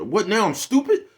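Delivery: high, loud indignant woman voice; mock offended woman. Imitating a touchy woman taking offense at advice. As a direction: squeal it like she's insulted.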